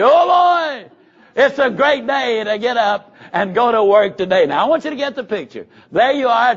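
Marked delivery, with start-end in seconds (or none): 0.0-4.5 much higher, louder burst of enthusiasm; forced cheer. Shown as a demonstration. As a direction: shout your good morning like you mean it. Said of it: "you slap your hands and you say".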